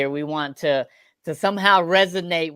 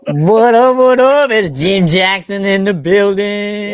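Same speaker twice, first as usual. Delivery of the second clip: loud, high, drawn-out hype call; big stretched-out arena shout; hyped shout-out; 4 semitones higher, 8 dB louder, slower than usual. Acted. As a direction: holler it like a hype man announcing a star.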